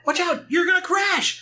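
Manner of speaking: very high, hammed-up cartoon shriek; panicked warning. Sonic warning Tails of a plane crash, panicked. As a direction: squeal the warning in mock terror.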